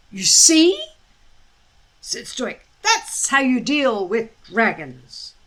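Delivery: a boastful father; smug chief. A narrator's reading. Stoic shows his son how to handle dragons, smug.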